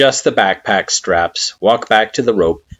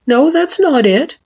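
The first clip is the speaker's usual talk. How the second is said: put-on higher child voice, gentle denial; Frisk; 5 dB louder than usual. A narrator's reading.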